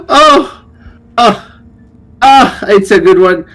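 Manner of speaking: high laugh tailing into amused sighs; high-pitched, in-character amusement; acted laughing. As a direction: play a character coming down from a laugh. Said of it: "Whisper starts to laugh".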